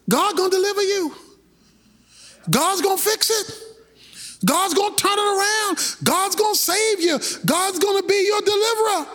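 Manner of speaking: a hint of a leader promising deliverance; Moses encouraging the people. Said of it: "Moses was just talking to the people".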